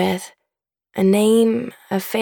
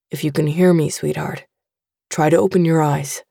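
a lower, gentle dad's voice; father asking his unconscious daughter, lower, gentle; fatherly voice; 5 semitones lower than usual. A narrator's reading. Father urges daughter to wake.

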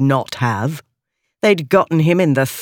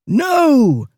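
a loud, high anguished no; desperate scream; 9 semitones higher, 3 dB louder than usual. A narrator's reading. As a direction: scream it as the treasure is taken.

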